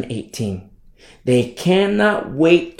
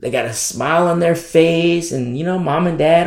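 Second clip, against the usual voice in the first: ordinary talk, nothing marked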